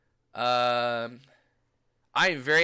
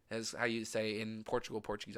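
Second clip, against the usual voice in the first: ordinary delivery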